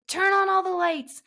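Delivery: high, loud drawn-out holler; yelling. Retold or imitated. Yells to Devin before racing to the airport, yelling. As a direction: call it out urgently across the house. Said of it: "I yelled to Devin".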